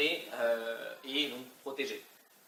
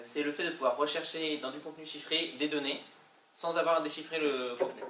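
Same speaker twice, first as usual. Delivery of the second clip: normal talking voice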